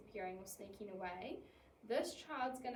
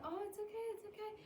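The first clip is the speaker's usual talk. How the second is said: anxious reassuring; 10 semitones higher, faster than usual. Retold or imitated. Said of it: "I'm not going".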